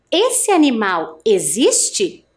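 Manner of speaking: slow "Esse animal existe?" with rising question end; question intonation. Shown as a demonstration. Teacher reads for class to repeat, slow rising question. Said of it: "Eu vou ler uma vez e você repete aí".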